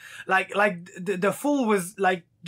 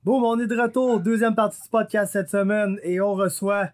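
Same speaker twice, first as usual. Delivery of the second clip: podcast host intro. Acted. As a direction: kick off the new segment like a radio host. Host opens podcast segment.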